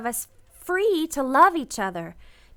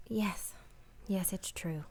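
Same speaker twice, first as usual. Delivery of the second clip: a bare whisper, much softer; whispered admission; 7 semitones lower, 11 dB quieter than usual. Acted. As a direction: breathe the confession out. Intimate admission at the station.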